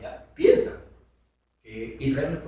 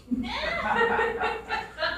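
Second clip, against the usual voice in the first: laughing; 3 dB quieter than usual. Real, not acted. Laughing at the ark smell.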